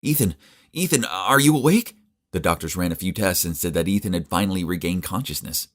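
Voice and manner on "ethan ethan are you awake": high eager calling; urgently hoping he wakes; hopeful call. Acted. Calling to waking boyfriend.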